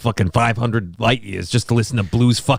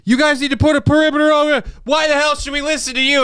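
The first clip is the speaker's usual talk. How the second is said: much higher, mocking voice of a defiant cop; mocking squeaky cop; 12 semitones higher than usual. Mocking the cops who question Captain America, high and squeaky.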